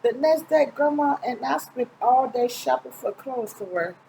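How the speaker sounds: usual speaking voice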